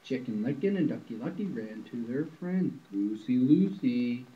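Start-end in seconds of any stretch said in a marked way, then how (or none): none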